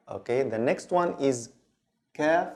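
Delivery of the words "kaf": English K in "kaf"; ordinary K without opened throat; normal K. Shown as a demonstration. Teacher introducing the next letter, English-like K. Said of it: "like the English K".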